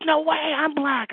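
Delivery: high, loud, slow mock amazement; amazed disbelief. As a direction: stretch it out, floored. Retold guy's amazed reply.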